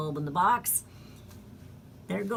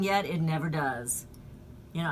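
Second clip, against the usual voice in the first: ordinary talk, nothing marked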